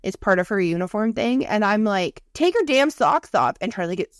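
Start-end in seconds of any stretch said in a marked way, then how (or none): 2.3-3.6 high, slow exasperated telling-off; wife ordering husband, exasperated; mock stern order. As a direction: drag it out, fed up with him. Scolding husband over socks.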